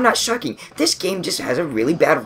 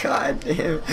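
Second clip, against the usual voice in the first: whispered under breath; 8 dB quieter than usual. Real, not acted. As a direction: breathe it out softly. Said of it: "whispered aside".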